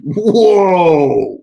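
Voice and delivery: loud high whoa of disbelief; astonished whoa. Real, not acted. Streamer reacts to a viewer's shocking lab number, astonished. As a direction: let it burst out, stunned.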